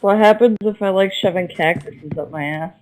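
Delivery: normal talking voice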